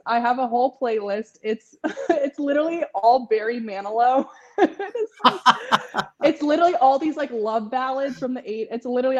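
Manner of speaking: real laughter breaking through the words; fighting to get the words out through giggles; laughing hard. Real, not acted. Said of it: "I can't even breathe".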